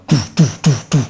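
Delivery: sung doo doo imitating bass; speaker music. Describing the car's speakers, hummed bass imitation. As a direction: mimic booming car speakers. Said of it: "it goes like".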